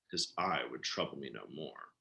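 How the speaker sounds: a low, soft, satisfied hush; hushed triumph. A narrator's reading. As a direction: savor it quietly, almost to yourself. Quiet triumph after murder.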